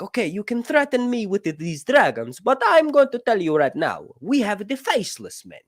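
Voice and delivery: high, loud mock Italian Mario accent; cocky Italian Mario accent pushed up high. Sea Lord threatening the Hand with assassins in Mario accent. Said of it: "doing the Mario voice".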